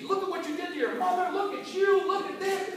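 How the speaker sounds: high, loud scolding dad; father cussing out his broke son, high and angry. Retold or imitated. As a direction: berate your son like a furious father. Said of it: "he just went into me".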